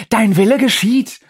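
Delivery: loud, high devil's proclamation; devilish visitor voice. Acted. Undine as the devilish visitor, proclaiming loud and high. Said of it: "mit der Stimme des teuflischen Besuchers".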